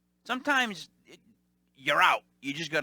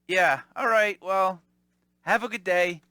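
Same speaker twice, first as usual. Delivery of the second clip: resigned brush-off; 4 semitones higher, 7 dB louder than usual. Retold or imitated. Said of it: "I was just like".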